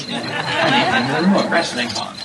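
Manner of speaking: a light, laughing put-on British accent; mock British accent. Debater envying British cadences, mock British accent. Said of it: "it just sounds brilliant".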